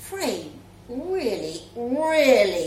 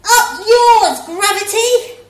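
shouted high and loud, fists in the air; full-throated defiant yell; 8 semitones higher, 13 dB louder than usual. Acted. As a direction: yell it at gravity itself. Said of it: "she really yelled".

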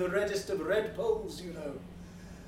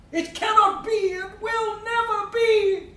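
imitating drunk; 12 semitones higher, 5 dB louder than usual. Quoting the drunken fellow.

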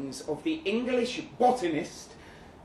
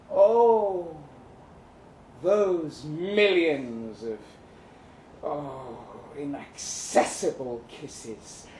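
mock lament; 4 semitones lower, slower than usual.

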